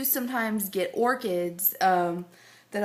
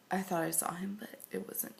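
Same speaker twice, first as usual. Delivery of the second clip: breathy whispered aside; 10 dB quieter, faster than usual. Real, not acted. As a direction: drop to a whisper. Aside about the shaved neck.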